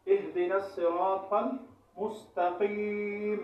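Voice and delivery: very slow Quranic recitation with long vowels; model recitation. Shown as a demonstration. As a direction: draw out each long vowel as a teacher's model. Teacher models the correct recitation.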